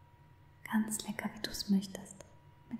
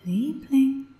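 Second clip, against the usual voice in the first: "Liebling" voiced, louder and higher, falling; louder wake-up call; 5 semitones higher, 10 dB louder than usual. Waking the captive lover. ("Liebling" laut und höher gerufen, fallend)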